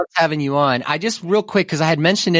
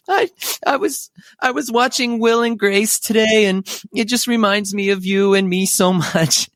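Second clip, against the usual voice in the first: high, teary imitated friend; high-pitched mock crying friend; emotional Angie; 9 semitones higher than usual. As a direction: gush through tears about Will and Grace.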